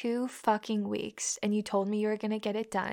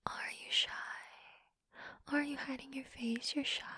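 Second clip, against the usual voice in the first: unvoiced whispered mockery; whispering taunts at the shy guy; taunting whisper; 15 dB quieter than usual. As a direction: tease him in a hushed whisper. Taunting in hushed voice.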